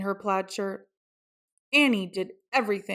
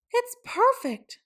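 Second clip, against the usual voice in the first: delighted mom. A narrator's reading. Mom sees plaid outfit.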